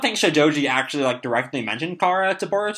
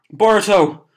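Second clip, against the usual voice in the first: calling out; 6 semitones higher, 6 dB louder than usual. Acted. Konohamaru calls after Boruto.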